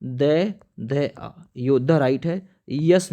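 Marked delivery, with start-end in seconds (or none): 0.0-1.3 letter by letter, slowly; slow spelling out. Shown as a demonstration. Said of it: "कैसे लिखते हैं?". (धीरे-धीरे अक्षर गिनाते हुए)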